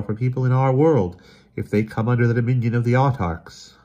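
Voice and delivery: high, emphatic character voice; grave Emerson, dire warning. Acted. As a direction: spell out the awful fate with urgency. Emerson explains grim fate.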